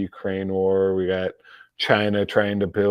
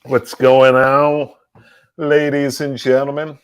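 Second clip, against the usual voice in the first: hearty host greeting; 4 semitones higher, 8 dB louder than usual. Real, not acted. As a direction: boom it out to the whole chat. Greeting the live chat loudly.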